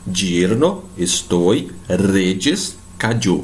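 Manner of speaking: "yerno, estoy, reyes, cayó" with a hard DJ. Shown as a demonstration. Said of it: "com som de D J". ("yerno, estoy, reyes, cayó" com som de DJ)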